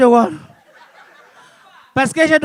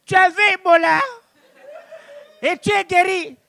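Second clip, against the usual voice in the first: pasteur choqué; 7 semitones higher than usual. Retold or imitated.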